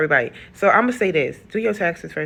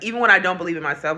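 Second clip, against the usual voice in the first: ordinary talk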